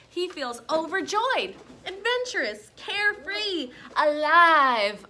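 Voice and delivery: high, rising, overjoyed list; joyful build. A narrator's reading. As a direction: let the joy build word by word. Teacher reads squirrel's joyful flight.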